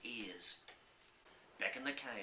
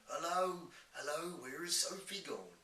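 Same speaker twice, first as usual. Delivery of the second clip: giant imitating worried parents calling out; higher, calling through the house for a lost child; half-shouted search call in a giant's voice; mild shout; 7 semitones higher than usual. A narrator's reading.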